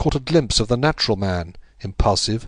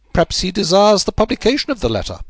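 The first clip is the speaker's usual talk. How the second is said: higher, musing suggestion; Holmes, speculative; 11 semitones higher, 5 dB louder than usual. A narrator's reading. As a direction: test a theory aloud. Narrator voicing Holmes.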